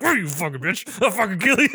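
loud, high mock-psycho threat; snarling Santa. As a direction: yell it like an unhinged Santa.

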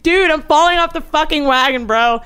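high, loud mock wail; imitating tearful girl. Storyteller voicing the crying girl at the loft, loud mock distress. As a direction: wail it as a hammed-up meltdown. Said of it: "there's a girl in tears".

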